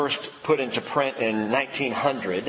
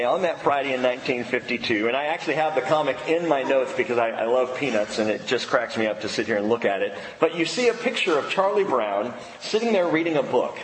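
normal talking voice

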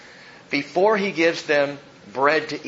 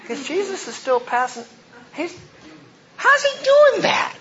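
high squeaky disbelief, climbing to a louder peak; comic bewilderment; 4 dB louder than usual. Acted. Apostles watching Jesus still passing bread, comically astonished. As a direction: build to a high-pitched baffled cry.

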